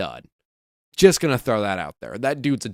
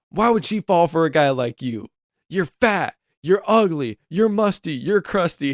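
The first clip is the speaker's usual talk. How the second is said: higher, mean taunt at Otis in a lightly voiced heel; mocking heel taunt; 6 semitones higher, 3 dB louder than usual. Austin Theory taunting Otis on the apron, mocking higher jeer. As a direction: jeer at him like a cocky heel. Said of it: "and he's like".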